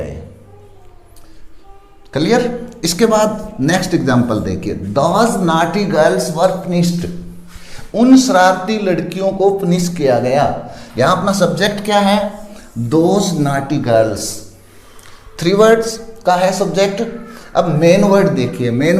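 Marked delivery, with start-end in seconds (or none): 4.7-7.1 slow dictation pace; slow example read. Shown as a demonstration. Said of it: "teacher reads example sentence". 12.8-14.2 drawn out very slowly; slow dictation pace; slow repeat. Shown as a demonstration. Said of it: "teacher repeats subject slowly".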